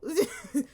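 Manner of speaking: high, loud laugh; loud burst of laughter. Real, not acted. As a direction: crack up laughing. Laughs after her imitation.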